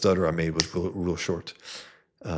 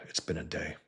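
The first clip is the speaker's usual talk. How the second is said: soft tired sigh, then quiet words; weary sigh; 10 dB quieter than usual. Retold or imitated. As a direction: exhale heavily, then say it worn out. Tired after bad day.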